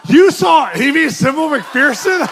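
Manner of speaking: astonished cry at the news; shocked disbelief. Retold or imitated. To great aunt Millie, shocked shout of disbelief.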